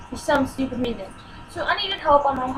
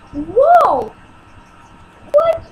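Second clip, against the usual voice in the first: loud, high outraged whoa what; shocked yell; 10 semitones higher, 9 dB louder than usual. Retold or imitated. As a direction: shout it up high in disbelief. Retold shock at teacher.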